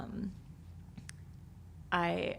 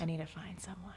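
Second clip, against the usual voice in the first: playful whisper; 8 dB quieter than usual. Real, not acted. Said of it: "playful whisper".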